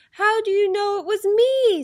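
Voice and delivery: high, loud turkey protest; indignant turkey. A narrator's reading. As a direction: cry out as a found-out turkey. Turkey caught in horse costume.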